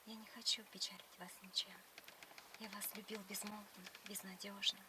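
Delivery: whispered poem recitation. Pretend. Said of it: "сначала ты стих расскажи мне".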